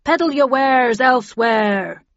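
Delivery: loud, slow captain's command; old sea captain. A narrator's reading. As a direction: order them off like a sea captain. Nemo continues shooing witches.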